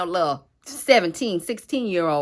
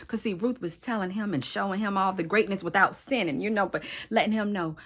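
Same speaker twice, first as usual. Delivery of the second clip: ordinary delivery, nothing marked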